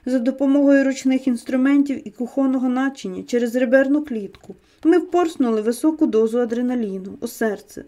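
usual speaking voice

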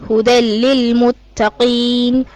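slow, measured tajweed chant; Quran recitation. Acted. Student reciting Baqarah to her teacher, chanted. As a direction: recite each verse as a trained reciter.

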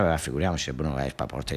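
low, soft TV-host murmur; Bruno Vespa voice. Retold or imitated. [name] as Bruno Vespa refusing politely, low and soft. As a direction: keep it deep and hushed like the host. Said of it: "e [name] ci sa".

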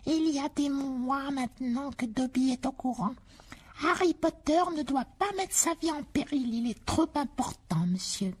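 high, slow house-elf voice; slow, high, earnest elf; house elf Dobby. Acted. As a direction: squeak it out like an earnest little elf.

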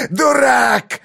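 high shrieked insult; shouting. A narrator's reading. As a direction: scream the word out in triumph. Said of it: "закричал он".

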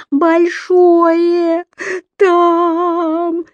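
high, loud, drawn-out whimpering cry; fearful child's wail. A narrator's reading. Еля showing papa what is under her bed, fearful wail. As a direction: wail it like a scared little girl. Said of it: "Еля боязливо показала лапкой".

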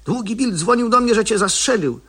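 can't believe he's alive; astonished. Acted. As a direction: sheriff stunned to see Tom alive. Sheriff sees the shot man alive.

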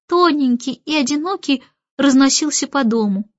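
ordinary delivery, nothing marked

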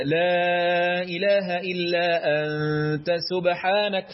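لا held long in لا إله إلا; elongated la. Shown as a demonstration. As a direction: stretch the لا slowly before إله. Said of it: "لو انت هتمد للتعظيم".

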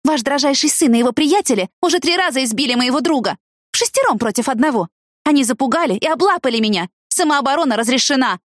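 high-pitched indignant rebuke; mildly hissed counter-accusation; angry hissing. A narrator's reading. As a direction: tell the mother off, pitched high. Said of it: "прошипела я матери Анджела".

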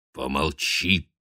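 slow, low firm hush; stern rebuke. A narrator's reading. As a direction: cut him off sternly. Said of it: "одёрнул его".

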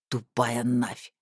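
low, very slow insult; contemptuous thug. A narrator's reading. Thug insulting the captive, low slow contempt. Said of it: "добавил второй".

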